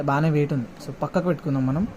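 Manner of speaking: usual speaking voice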